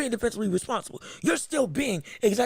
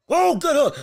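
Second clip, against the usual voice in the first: excited; 5 semitones higher, 6 dB louder than usual. Real, not acted. Excited call of a punch.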